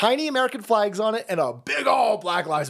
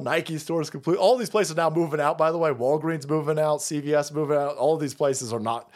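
ordinary talk, nothing marked

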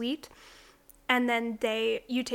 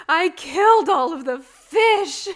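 put-on slow, loud little-girl confession, pitched up; little girl confessing; 5 semitones higher, 11 dB louder, slower than usual. Little girl confessing to Missus Claus, slow loud childish voice. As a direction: spell out the crime like a guilty small child. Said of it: "this little girl is like".

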